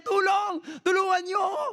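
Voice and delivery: high-pitched acted plea for help; mock cry for help. Preacher acts the fallen man begging for help, crying out. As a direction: call out high like someone about to be eaten. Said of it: "sigaw siya ng sigaw".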